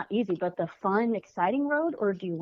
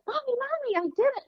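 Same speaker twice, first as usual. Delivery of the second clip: put-on much higher, bright child voice calling Mommy; excited little daughter; faster than usual. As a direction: squeal it out like a proud kid.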